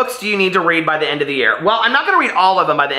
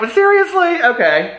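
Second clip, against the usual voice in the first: much higher, disbelieving "seriously?"; mock outrage, pitched way up; incredulous; 14 semitones higher than usual. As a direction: scoff at the lion just walking off. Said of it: "I'm like".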